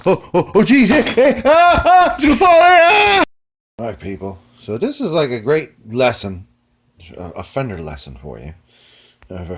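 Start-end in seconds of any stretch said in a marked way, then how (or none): 0.1-3.2 hoser panic rising to a high scream; comic panic. Acted. Camera holder about to drop it, comic panicked shouting. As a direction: yell higher and higher as disaster looms.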